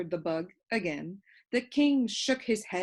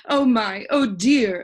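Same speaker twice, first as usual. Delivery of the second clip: breathless; 3 semitones higher, 9 dB louder, slower than usual. A narrator's reading. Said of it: "breathing with great difficulty".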